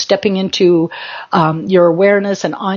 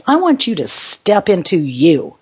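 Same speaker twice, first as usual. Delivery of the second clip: normal talking voice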